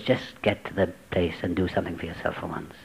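a small voice inside urging quietly; little inner whisper. Retold or imitated. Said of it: "went off like a little whisper".

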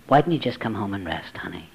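hushed, soft and caring; soft loving whisper; tender whisper. Retold or imitated. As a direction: whisper it gently like a kind old lady. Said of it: "a tender lady voiced in the story".

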